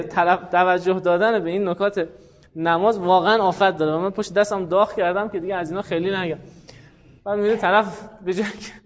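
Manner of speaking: usual speaking voice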